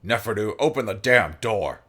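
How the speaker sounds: strained demand. A narrator's reading. Kato over the speakers orders the door open, strained. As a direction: demand it loudly, under strain. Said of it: "It's, um, strained and, um, breathing heavily".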